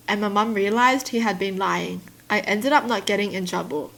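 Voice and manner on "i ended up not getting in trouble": ordinary talk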